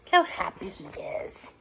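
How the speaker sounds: quiet reader's comment; soft aside. Real, not acted.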